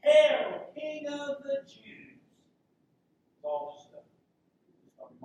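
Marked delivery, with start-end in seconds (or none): 0.0-2.2 loud, high mock salute to a fake king; a loud, drawn-out sneering hail. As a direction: soldiers jeering at Jesus, mocking loud hail. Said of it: "and mocked him".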